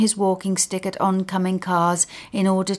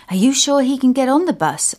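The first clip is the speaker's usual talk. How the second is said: kind woman; 6 semitones higher, 5 dB louder, faster than usual. Acted. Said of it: "asks the angel in cream".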